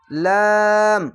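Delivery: লাম with a very long, level aa; chant-like, drawn-out লাম; three-alif stretch. Shown as a demonstration. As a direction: hold লাম on one note. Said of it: "তিন আলিফ টেনে পড়বে".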